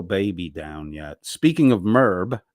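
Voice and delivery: ordinary delivery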